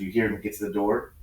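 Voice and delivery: ordinary talk, nothing marked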